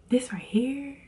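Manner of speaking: breathy voiceless whisper of her catchphrase; imitating It's So Good. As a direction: whisper it like her signature line. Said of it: "When she be like".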